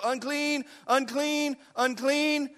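acted outcast's warning cry; street cry. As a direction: call it out in evenly spaced, sustained cries. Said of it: "go through the street and go".

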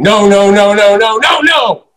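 loud, high, rising run of cries; retold screaming. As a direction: imitate someone screaming in escalating protest. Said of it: "he started screaming".

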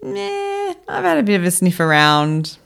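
high-pitched, dismissive "nah" from a lightly voiced lion; uninterested lion. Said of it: "that lion goes".

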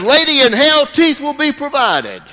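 loud, high triumphant retort; preacher's comeback. Retold or imitated. Evangelist answers the toothless woman, loud and high. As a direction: fire back the punchline. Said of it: "the guy says".

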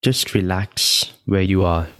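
ordinary delivery

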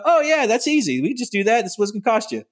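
high, easygoing official brushing it off; lightly voiced cheerful official; breezy county official. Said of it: "And he's gonna go".

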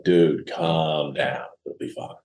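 slow, soft "calm down", fading to a whisper on "it'll be fine"; quietly calming the doctor stuck in the elevator; retold calming. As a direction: talk a panicking stranger down, hushed. Calming a panicking man in elevator.

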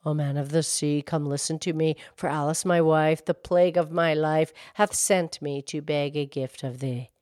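low, soft, even incantation; hushed verse to the sea; subdued calling rhyme. A narrator's reading. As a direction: recite the summons low and softly, barely calling. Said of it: "and he called out".

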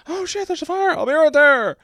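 mock panic. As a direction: squeal it out like a startled old man spotting fire. Panicked character spotting a fire.

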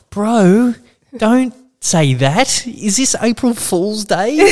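high, drawn-out mock alarm; incredulous protest. Patient to the staff remarking on blood, high-pitched incredulous protest. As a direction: stretch it slowly in high-pitched disbelief.

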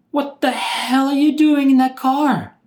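high, loud angry yell at the cousin; retold yell. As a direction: bark it out, furious and shocked. Yelling at cousin in car.

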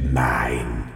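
low, drawn-out goblin claim. A narrator's reading. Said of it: "in a goblin way".

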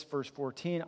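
ordinary delivery

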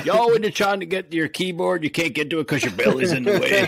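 put-on Southern accent. Teasing Dan with a fake question in a hammed-up Southern accent. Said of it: "I don't know why I got a Southern accent".

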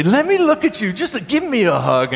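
high, gushing grandma voice; excited grandmother. Retold or imitated. Grandmother holding the boy by the shoulders, gushing high. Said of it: "grab me by the shoulders and say".

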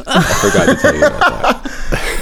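slow, high, breaking into laughter; deadpan joke that cracks into laughter; laughing. Real, not acted. Teasing friends about never going, laughing. Joking about twenty-three Seeks.

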